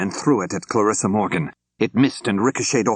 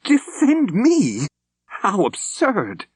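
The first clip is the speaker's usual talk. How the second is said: much higher, slow, scoffing disbelief; scornful snort; much slower than usual. A narrator's reading. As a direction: scoff at the very idea. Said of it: "Armstrong snorted".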